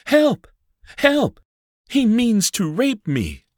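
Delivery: very high, loud screams for help; crying for help. A narrator's reading. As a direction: scream for help while fleeing.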